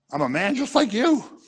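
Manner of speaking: high-pitched imitated protest; mock protest. Peter protests at being worshipped.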